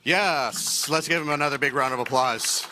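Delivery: loud, high call for applause; rallying shout. Real, not acted. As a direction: whip the crowd into applause. Host rallying crowd for applause.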